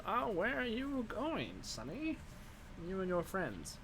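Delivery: put-on high, slow old crone voice; wizened old crone. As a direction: croak it like a kindly old witch. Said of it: "wizened old crone voice".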